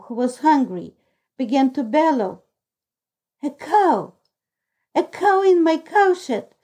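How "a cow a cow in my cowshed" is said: higher-pitched cry of surprise; narrated character's startled exclamation; astonished cry. A narrator's reading. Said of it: "cried Mother Barberin".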